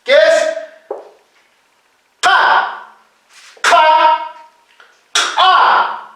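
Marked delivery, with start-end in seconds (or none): 2.2-2.8 "ka" as plain q, far back in the mouth, no puff; simple consonant. Shown as a demonstration. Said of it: "Consonantes simples". 3.6-4.3 "ka" as back q with a rush of air; aspirated consonant. Shown as a demonstration. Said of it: "consonantes aspiradas". 5.4-6.1 "a" launched by a popped back q; reinforced ejective consonant. Shown as a demonstration. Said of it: "Consonantes reforzadas".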